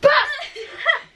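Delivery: high shrieking laugh; loud burst of laughter. Real, not acted. Laughing loudly at her sister's teasing, a high shriek.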